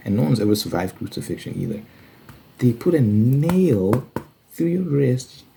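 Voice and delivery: ordinary talk, nothing marked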